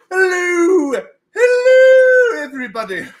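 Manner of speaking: high, loud, drawn-out falsetto hello; sing-out falsetto greeting; hooted greeting. Acted. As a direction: trill a big high hello to the audience. Stream greeting in Doubtfire-like hoot.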